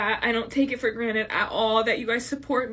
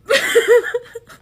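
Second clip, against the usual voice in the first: real sudden loud sob-laugh, higher; 9 semitones higher, 10 dB louder than usual. Real, not acted. Creator sobbing a goodbye to her followers, real loud sob-laugh. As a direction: sob breaking out mid-sentence. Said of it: "every single time I start bawling".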